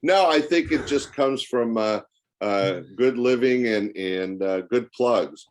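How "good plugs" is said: usual speaking voice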